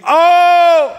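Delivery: high, loud drawn-out cry; long wailing shout, far above speaking pitch; imitated dying scream. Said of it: "screaming and cursing at God. Screaming".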